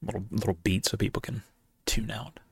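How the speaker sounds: hushed aside whisper. Real, not acted. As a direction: whisper it under your breath. Pausing for spoiler warning.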